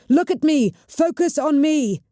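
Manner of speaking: high, loud urgent commands; yelling. A narrator's reading. Cheta keeping the witch's eyes on her, high loud yell. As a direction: pull her focus back. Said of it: "Cheta yelled".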